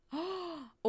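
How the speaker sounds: high, soft gasp; sudden intake of delighted surprise; excited gasp. Real, not acted. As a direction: gasp at a new idea. Sudden delight at the lake idea.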